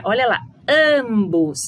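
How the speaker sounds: ordinary delivery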